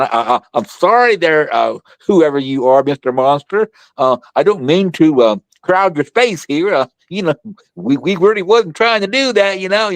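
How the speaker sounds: backing away while pleading; nervous apology. Retold or imitated. Boy backing away apologizing to Bigfoot, mock-nervous stammer.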